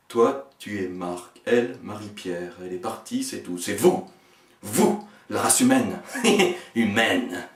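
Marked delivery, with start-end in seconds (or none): 6.0-6.7 high manic laugh. Acted. Laugh mid-line.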